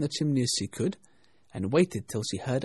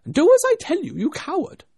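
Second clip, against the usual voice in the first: high, loud scolding order; angry rabbit; 11 dB louder than usual. A narrator's reading. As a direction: berate a cowardly servant. Rabbit bullies the reluctant servant.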